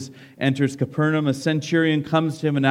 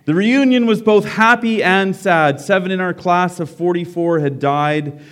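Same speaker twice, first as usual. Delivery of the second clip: higher, louder read-aloud voice; story reading; 5 semitones higher, 6 dB louder than usual. A narrator's reading. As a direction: read it out to the whole room. Reads a book story aloud.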